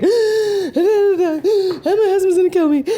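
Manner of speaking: high, slow, sobbing wail through a laugh; retold sobbing. Telling the officer her husband will kill her, sobbing high. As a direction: replay the panicked crying at the window. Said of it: "I am bawling my eyes out".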